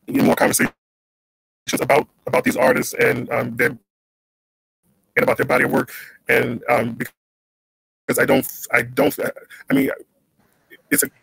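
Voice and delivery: choppy cutting-out line; breaking up on a bad connection; scrambled voice. Real, not acted. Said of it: "your line is cutting up".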